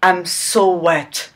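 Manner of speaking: ordinary delivery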